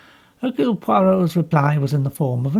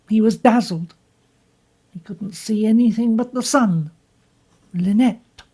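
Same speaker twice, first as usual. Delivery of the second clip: higher, bitter and defensive; bitter young woman; 6 semitones higher, 4 dB louder than usual. Acted. Jacqueline bitterly tells Poirot what happened. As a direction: push back at him with bitterness.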